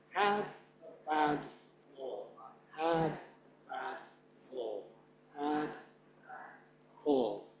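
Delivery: soft, evenly spaced "had", "bad", "fall"; hushed metronome chant of "had", "bad", "fall"; steady stress beats. Shown as a demonstration. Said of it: "beginning with the red syllables".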